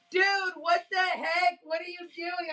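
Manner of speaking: put-on like a teen caught off guard; shocked. Daughter shocked at belly flash.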